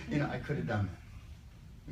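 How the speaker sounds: ordinary talk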